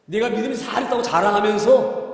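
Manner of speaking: usual speaking voice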